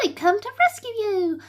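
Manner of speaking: high, proud announcement; rescuing dragon, out of breath and proud; proud and puffed. Acted. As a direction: puff out your chest. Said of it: "puffed proudly".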